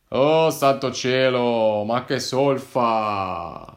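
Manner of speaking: slow exasperated grumble; grumbling. Acted. De Martino realizes the wrong canton, grumbling. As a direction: drag it out, fed up. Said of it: "borbottò il De Martino".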